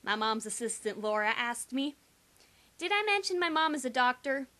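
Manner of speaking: ordinary delivery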